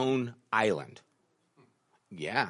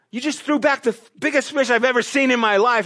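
retold shocked outburst; 9 semitones higher, 4 dB louder, faster than usual. Shocked at fish thrown back.

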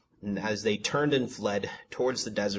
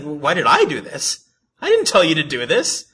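high, loud mock indignation; God's indignant response; 7 semitones higher, 11 dB louder than usual. God answering the crying people, indignant retort. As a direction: throw the question back, loud and incredulous. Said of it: "I just imagine God's response".

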